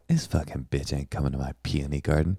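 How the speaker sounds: under-the-breath scorn; quiet catty inner voice; mock muttered aside. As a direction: say it low and snide to yourself. Voiced inner thought of host.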